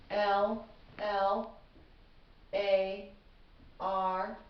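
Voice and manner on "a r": ordinary delivery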